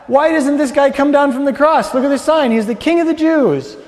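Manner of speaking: high, loud soldier's jeer; mocking mimicry. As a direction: pile on the taunt, voice pushed up. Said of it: "began mimicking".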